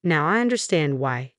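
plain reading voice

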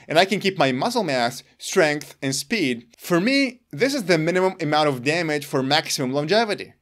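ordinary delivery

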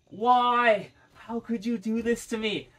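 high, loud "why" in mock anguish; slow, high imitation of begging; begging Uber driver. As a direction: plead like a betrayed lover, hammed up. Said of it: "he was fucking begging for me".

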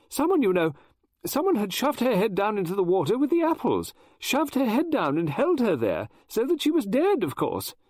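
high, loud, distressed rush; barely holding together as she tells it; near hysterical. Acted. Mrs Oliver recounting the drowning to Poirot, near hysterical. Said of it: "I was getting hysterical".

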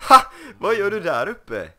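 loud, high startled cry at the cow; sudden high yelp of surprise; surprised shout. Real, not acted. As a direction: call up in disbelief. Cow spotted up in tree.

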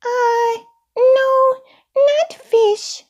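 very high puppet voice, hesitant refusal; extremely high little character; Elmo. A narrator's reading. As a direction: refuse in a tiny squeaky-high voice. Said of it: "said Elmo".

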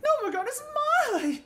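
high, shouting angry gnome. Acted. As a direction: rage like a greedy little gnome. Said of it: "can probably do a good gnome voice".

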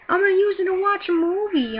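high put-on old-lady voice. Old lady wanting Xbox for a movie, insistent voice. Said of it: "this old lady comes in and goes".

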